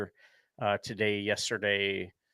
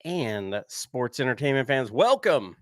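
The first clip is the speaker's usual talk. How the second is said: ringmaster; 4 semitones higher, 8 dB louder than usual. Pretend. Podcast opening announcer intro.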